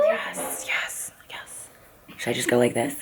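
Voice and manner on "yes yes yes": hushed, breathy whispering; whispered yes. Real, not acted. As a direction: whisper the repeated cheer, fading softer. Hyping her hair volume, under breath.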